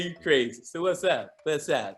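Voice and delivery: usual speaking voice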